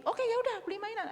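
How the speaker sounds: giving in. Acted. Parent giving in to tantrum.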